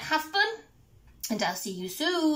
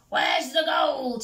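loud, drawn-out pirate shout; shouted demand; 5 semitones higher, 6 dB louder than usual. A narrator's reading. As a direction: shout it slowly as an acted demand. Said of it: "shouted Hank Chief".

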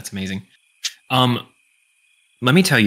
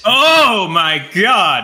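shocked; 8 semitones higher, 5 dB louder, much slower than usual. Real, not acted. Reacting to the AI's voice.

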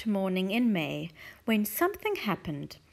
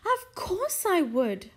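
eager cry; 10 semitones higher, 4 dB louder, slower than usual. Acted. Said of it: "Missus Silver cried".

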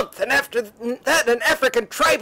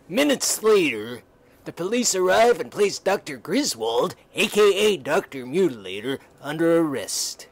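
story narration; 8 semitones lower than usual. A narrator's reading. Narrator leading listeners into the secret chamber, low storytelling voice. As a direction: let the narration sink low and hushed. Narrator between character lines.

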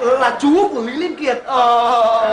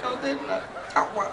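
much softer, quick and meek; meek answer; 11 dB quieter, faster than usual. Acted. Boy meekly telling the policeman his name, soft and quick. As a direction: mumble your name quickly and timidly.

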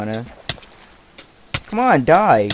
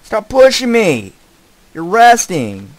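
high, loud indignant shout; annoyed yell; 8 semitones higher, 10 dB louder than usual. Real, not acted. Player shouts at a villager pushing him, indignant yelling. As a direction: bark it at whoever shoves you.

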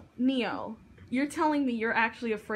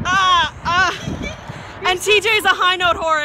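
loud teasing; 4 semitones higher, 8 dB louder, much slower than usual. Real, not acted.

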